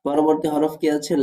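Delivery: ordinary delivery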